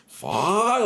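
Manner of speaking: high, drawn-out mock curse; exasperated yell at no great volume; imitated mad outburst. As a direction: act out a frustrated gamer's cry. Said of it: "he would get so mad".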